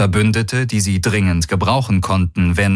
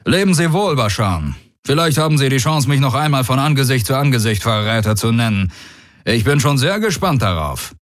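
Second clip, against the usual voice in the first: higher, fast, ironic farewell; mocking warlord; 7 semitones higher than usual. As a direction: send him off with cheerful scorn. Daraios bids mocking farewell.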